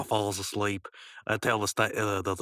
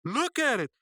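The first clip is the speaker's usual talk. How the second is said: "Look at it" pitched high; high bossy Mordecai. Pretend. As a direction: order him high and sharp. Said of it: "I wanna hear it".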